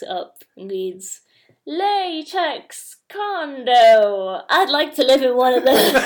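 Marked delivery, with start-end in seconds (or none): 1.6-5.9 high, loud, drawn-out old man impression; Grandpa reading aloud. Co-host quoting Grandpa's misreading of a find, hammed-up old man. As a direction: read it out slowly and loudly, breaking into laughter.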